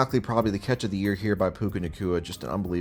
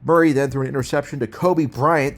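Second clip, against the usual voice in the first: higher and louder, Murray punched; emphatic repeat; 6 semitones higher, 5 dB louder than usual. Shown as a demonstration. Narrator recaps the game, repeats the pick louder. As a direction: call the interception again with more fire. Same play called twice.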